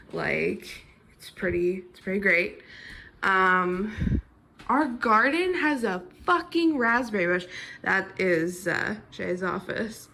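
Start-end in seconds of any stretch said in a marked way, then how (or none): none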